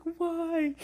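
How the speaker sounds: high, pitiful mock wail; imitated sobbing. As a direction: re-enact a tearful plea, high-pitched. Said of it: "I was literally sobbing".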